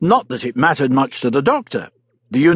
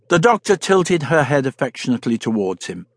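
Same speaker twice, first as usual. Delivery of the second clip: usual speaking voice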